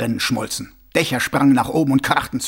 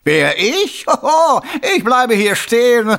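high, loud mocking voice; a smug killer playing innocent; sneering villain; 12 semitones higher, 8 dB louder, slower than usual. A narrator's reading. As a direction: Carcer mocking the watchman. Karzer mocks.

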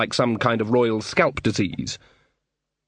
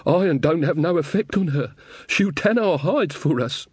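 higher-pitched country villager dreading punishment; fretful rustic; 6 semitones higher than usual. Acted. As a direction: fret in a high rustic voice. Frightened Carter, rustic villager.

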